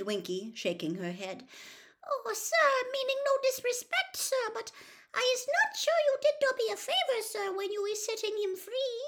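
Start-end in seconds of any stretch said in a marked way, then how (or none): 2.1-9.0 very high, deferential elf voice; Winky's squeak. A narrator's reading. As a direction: object politely in a tiny squeak. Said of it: "quivering squeak of a voice".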